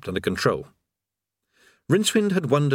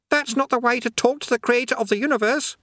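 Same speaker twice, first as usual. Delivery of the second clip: higher, urgent rebuke; shouted; 13 semitones higher than usual. Acted. Eric scolding Rincewind for rudeness, in a shout. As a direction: tell him off fast in alarm. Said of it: "he shouted".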